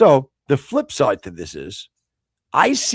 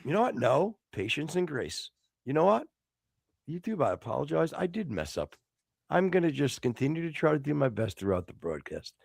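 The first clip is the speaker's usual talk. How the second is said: suddenly soft, restrained contrition; composed apology; 8 dB quieter than usual. Pretend. Host apologises to YouTube after his outburst, softly composed. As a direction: rein it right in, hushed and patient.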